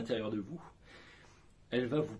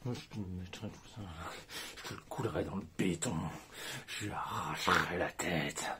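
menacing threat; 6 semitones lower, 3 dB quieter than usual. Shown as a demonstration. Coach voices angry threats, low and menacing. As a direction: threaten quietly like a mobster. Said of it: "la méthode qui fonctionne".

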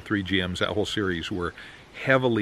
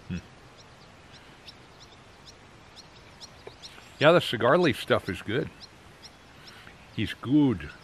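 talking around pipe stem; pipe in mouth; 4 semitones higher than usual. Real, not acted. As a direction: teeth clenched on pipe. Seen 0: pipe stem held in mouth throughout labelled words.

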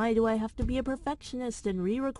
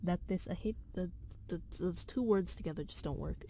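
usual speaking voice

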